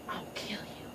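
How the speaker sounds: soft, menacing whisper; whispered cartoon threat. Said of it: "Kobu's like".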